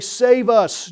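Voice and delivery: loud, high acted crowd cry; preacher loudly voicing the crowd's plea. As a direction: call out to a passing king for rescue. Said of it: "waving them and crying out".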